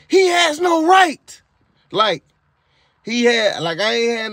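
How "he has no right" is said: high, slow, furious demon shout; slow high shouted protest. Retold or imitated. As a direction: bellow it like an enraged demon refusing to let go. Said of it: "the demon just kept saying".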